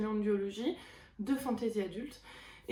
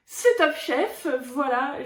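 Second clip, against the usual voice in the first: loud, high, slow announcement; triumphant reveal; 3 semitones higher, 8 dB louder, slower than usual. Real, not acted. Vlogger reveals her favourite show, loud and excited. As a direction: proclaim it like a big reveal.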